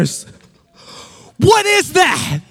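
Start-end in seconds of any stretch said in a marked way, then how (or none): 1.4-2.4 high, loud mock shriek of accusation; hammed-up furious mom; outraged mom. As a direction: pounce like a mother catching her kid. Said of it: "my mom just out of nowhere".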